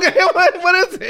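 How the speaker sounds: high, laughing question; amused confusion. Real, not acted. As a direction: ask through laughter. Laughing after being told no.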